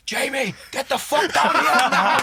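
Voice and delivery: high, loud, slow mock yell through a laugh; hammed-up call across the bar; mock shout. Imitating Klopp calling Jamie into the bar, mock shout. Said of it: "And I just did".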